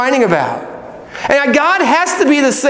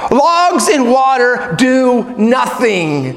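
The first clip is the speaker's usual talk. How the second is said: usual speaking voice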